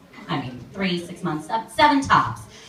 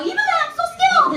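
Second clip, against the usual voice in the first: much higher, fast squeak; squeaky mock voice; 4 dB louder, faster than usual. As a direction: squeak it out mockingly. Mocking same guy.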